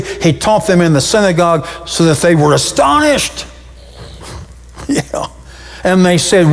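usual speaking voice

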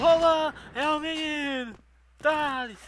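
high-pitched excited yelling; panicking gamer. Real, not acted. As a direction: shout it out mid-fight, high. Excited shout in a clutch round.

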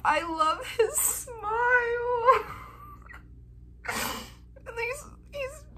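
squealing high over his smile; overwhelmed gushing. Real, not acted. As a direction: gush like you might cry. Fangirling over a photo.

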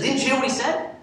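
high indignant question. Retold or imitated. Indignant scholar in story.

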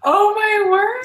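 high, loud, drawn-out astonishment; real stunned outcry, stretching the words; shocked. Real, not acted. Guest hearing the host's eyebrow confession, shocked.

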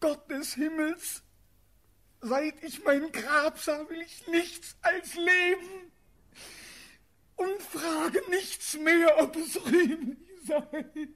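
high, anguished begging; desperate plea. Acted. Condemned prince begging his aunt for bare life, desperate plea. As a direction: cry out for life, pitched far up.

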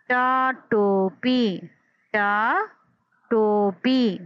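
टा then टोपी, very slow and split; teacher spelling टोपी aloud; sounding out टोपी. Shown as a demonstration. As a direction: pull टोपी apart into sounds. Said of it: "टोपी दो आवाज़ों में ब्रेक हो रहा है".

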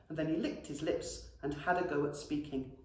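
ordinary delivery, nothing marked

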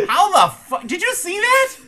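loud, high, laughing disbelief; amazed. Real, not acted. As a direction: shout it in shocked delight. Amazed at game glitch.

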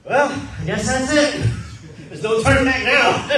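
slow, loud, laughing surrender; laughing resignation. Retold or imitated. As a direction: give in with a laugh.

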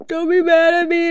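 dog begging its owner not to be mad; pleading dog voice. Retold or imitated. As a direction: give the dog a whiny little plea. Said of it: "and she's like".

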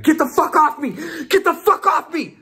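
high-pitched, loud panicked yell; yelling, cussing. Retold or imitated. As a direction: re-enact fighting off someone restraining you. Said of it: "like, yelling, cussing".

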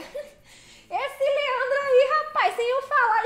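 high laughing disbelief, drawn out slowly. Real, not acted. Driver amazed a viewer knows the town, laughing high.

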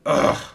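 acted groan, pushed out loud; exasperated groan. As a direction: let out a frustrated groan. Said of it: "groaned Stephen".

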